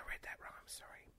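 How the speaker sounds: sheepish whisper. Real, not acted. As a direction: whisper an apology. Embarrassed after misreading.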